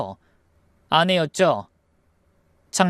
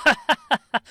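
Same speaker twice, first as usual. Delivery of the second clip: high gleeful cackle; excited laugh; 7 semitones higher than usual. Acted. Second brother thrilled about inheritance money, laughing gleefully. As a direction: burst into high, greedy laughter. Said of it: "둘째 형님도 돈 떨어지는 소리가 들리는지 흥분했죠".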